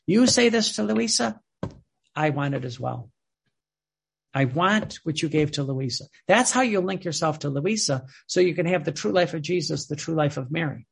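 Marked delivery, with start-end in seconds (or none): none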